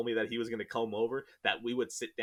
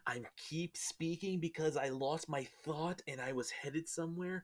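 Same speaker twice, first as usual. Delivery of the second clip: whisper; 4 semitones higher, 7 dB quieter than usual. Real, not acted. Losing thought, trailing off.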